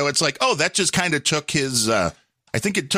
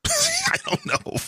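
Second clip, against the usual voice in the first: high squeaky laugh; words squeezed through laughter; 3 dB quieter, much slower than usual. Real, not acted. Laughing fit.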